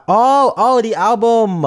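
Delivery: just remembered something; loud high realization; sudden realization. Real, not acted.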